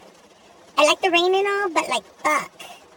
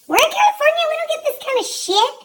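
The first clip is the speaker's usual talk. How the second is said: much higher, exasperated disbelief; high, indignant complaint; incredulous outburst; 13 semitones higher, 3 dB louder than usual. Real, not acted. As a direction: shriek it like you can't believe the weather. Disbelief about the thunder.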